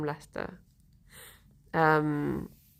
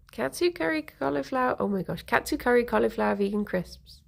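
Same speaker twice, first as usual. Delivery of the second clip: normal talking voice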